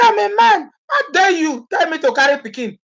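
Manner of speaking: a touch of a proud husband, scoffing; indignant African husband. Retold or imitated. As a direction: play the offended husband refusing to carry the baby. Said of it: "They say".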